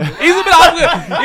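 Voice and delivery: a joking laugh through the words. Real, not acted. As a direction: laugh out the joke, high and loud. Joking about Mufti Monk, laughing.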